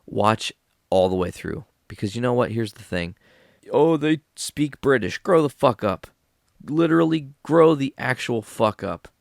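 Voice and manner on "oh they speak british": mocking whine. Mocking critics of British accent.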